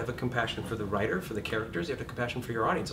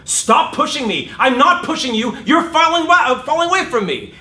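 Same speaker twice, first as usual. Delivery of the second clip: much higher and louder angry yelling; heated fight; 14 dB louder than usual. Shown as a demonstration. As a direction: yell it in the heat of a fight. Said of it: "you go".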